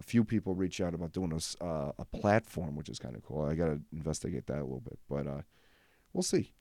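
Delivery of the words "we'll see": teasing sing-song. Real, not acted. Teasing about sponsors.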